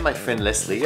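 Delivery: normal talking voice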